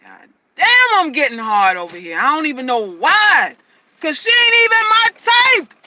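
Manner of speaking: loud, high, amazed man voice; hammed-up flustered man; aroused man. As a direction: play a guy caught off guard. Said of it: "He's gonna go".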